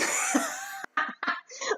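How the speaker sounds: high-pitched burst of laughter. Real, not acted. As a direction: crack up at your own bad accent. Cracks up at own accent.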